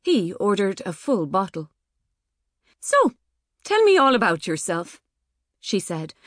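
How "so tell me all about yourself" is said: bright eager date voice. Acted. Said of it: "reminding herself to smile".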